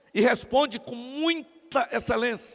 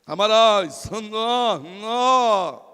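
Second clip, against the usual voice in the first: put-on oratorical voice; loud, swelling mock recitation; memorised speech; 2 semitones lower, 5 dB louder, much slower than usual. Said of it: "o discurso tá decorado, pode ver". (recitação decorada com voz forçada)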